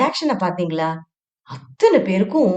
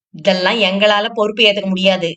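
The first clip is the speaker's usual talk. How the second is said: ordinary delivery, nothing marked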